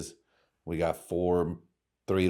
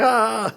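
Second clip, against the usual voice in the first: mock shriek; 7 dB louder than usual. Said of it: "I was like".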